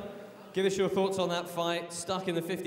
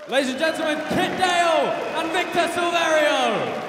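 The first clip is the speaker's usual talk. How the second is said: announcer shout; 13 semitones higher, 7 dB louder than usual. Real, not acted. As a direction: stretch the names out for the arena. Announcer closes match with shout.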